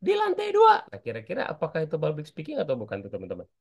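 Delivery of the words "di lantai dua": put-on much higher, a small child calling for papa; child's high call, a bit louder. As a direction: call out as a little kid looking for his father.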